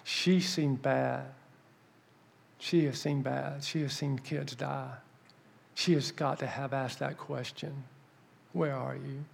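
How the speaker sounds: slow, soft and weighty; hushed awe. Retold or imitated. Telling his wife about the woman who has seen suffering, hushed awe. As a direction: confide it quietly, word by word.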